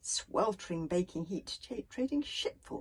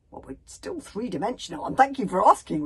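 mock-grateful sarcasm, louder on the thanks; sarcastic; 6 semitones higher, 11 dB louder than usual. A narrator's reading. Fishlegs answers the bodyguard after the rockfall, sarcastic. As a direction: lay the sarcasm on with a loud thank-you.

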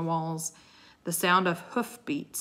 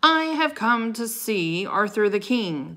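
loud, higher, grand proclamation; booming knight; 5 semitones higher, 7 dB louder than usual. A narrator's reading. Knight declares his business to the court, booming. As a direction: announce it loudly to the whole hall. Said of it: "His deep voice echoed from inside his helmet".

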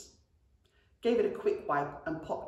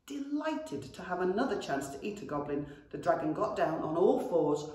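ordinary delivery, nothing marked

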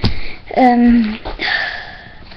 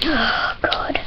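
breathy whisper, barely voiced; whispered dread; 11 dB quieter than usual. Real, not acted. Sour candy dread.

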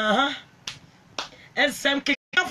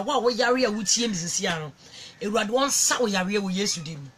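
rapid prayer; 3 semitones lower, faster than usual. Acted. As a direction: rattle off a blessing over the listener. Rapid spoken blessing prayer in Twi.

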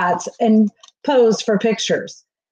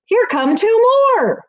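an excited announcer spotting two more racers; surprised announcer; 12 semitones higher than usual. A narrator's reading. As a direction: announce the late arrivals in surprise. Announcer spots late racers.

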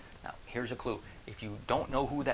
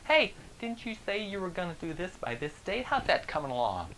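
high put-on voice, nosy and smug; lightly voiced smug coworker; mocking coworker; 8 semitones higher, 3 dB louder than usual. Coworker checking on deadline.

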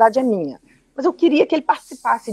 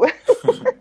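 high laugh; 12 semitones higher than usual. Real, not acted. Laugh after self-critique.

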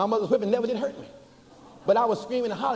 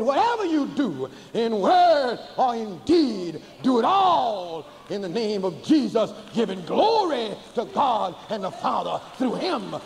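preaching at full force; 4 semitones higher, slower than usual. Acted. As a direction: drive each Jesus line home slowly to the congregation. Preaching climax.

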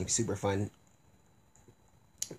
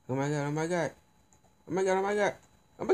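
panicked; 8 semitones higher, much faster than usual. Real, not acted. Panicking as floor lights up.

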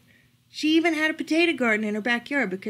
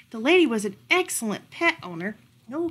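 plain reading voice

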